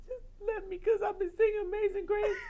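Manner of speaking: high, loud, crying shout; desperate plea. Retold or imitated. Singer begging John for his lyrics, desperate shout. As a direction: beg him, voice breaking.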